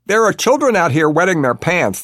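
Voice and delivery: loud, high furious plea; furious pleading. A narrator's reading. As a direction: plead furiously through a door. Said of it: "pleads in a furious voice".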